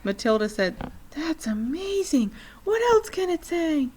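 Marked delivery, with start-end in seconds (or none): 1.1-3.9 put-on amazed girl. A narrator's reading. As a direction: marvel at the bird like a little girl. Matilda amazed by parrot.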